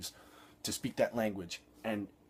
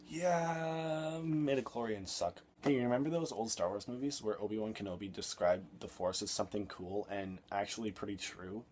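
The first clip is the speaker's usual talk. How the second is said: normal talking voice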